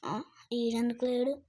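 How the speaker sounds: ordinary talk, nothing marked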